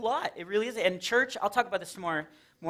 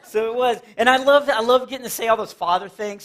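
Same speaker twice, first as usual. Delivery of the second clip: ordinary delivery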